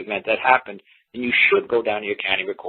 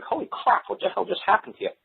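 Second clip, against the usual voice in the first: high-pitched disbelief, not shouted; baffled exclamation, voice pitched way up; mock astonishment; 11 semitones higher, 3 dB quieter than usual. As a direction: imitate stunned courthouse onlookers. Acts out baffled court onlookers.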